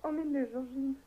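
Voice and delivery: ordinary delivery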